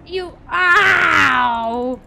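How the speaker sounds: loud, drawn-out wail of ow; pained outcry. Real, not acted. Gamer hit by an enemy in game, loud pained yell. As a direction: cry out as if just struck.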